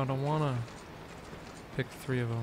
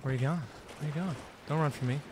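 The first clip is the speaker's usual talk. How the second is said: ordinary talk, nothing marked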